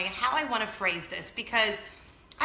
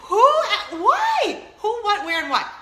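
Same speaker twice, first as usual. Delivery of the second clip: high, loud, laughing questions; too stunned to finish a question; laughing disbelief; 11 semitones higher, 9 dB louder, slower than usual. Real, not acted. Mother baffled by her daughter's question, laughing high and loud.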